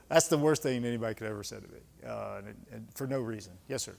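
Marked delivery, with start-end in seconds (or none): none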